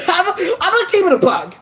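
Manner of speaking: sheepish laugh while admitting it. Real, not acted. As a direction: giggle out the confession. Laughs at own shyness confession.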